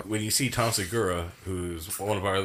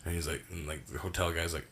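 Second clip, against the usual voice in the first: ordinary talk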